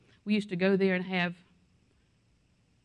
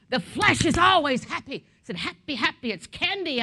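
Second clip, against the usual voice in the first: higher and louder, giddy voice of the flesh; gleeful flesh; 5 semitones higher, 6 dB louder than usual. Pretend. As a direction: play the flesh delighting in sin. Preacher voicing the happy flesh.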